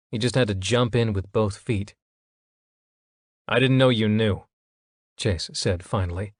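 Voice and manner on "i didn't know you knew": a touch of hurt, voice roughened; understated wounded reproach; rough edge of hurt. A narrator's reading. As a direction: let the hurt scrape through. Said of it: "putting a rough edge of hurt into his voice".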